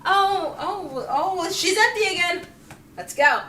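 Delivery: high, loud mock alarm; hammed-up high panicked call. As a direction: squeal like staff spotting her again. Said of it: "It's like".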